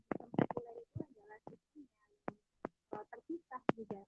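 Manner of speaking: very faint, far from the mic; barely audible. Real, not acted. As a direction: voice drifting away from the mic. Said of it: "suaranya terlalu kecil".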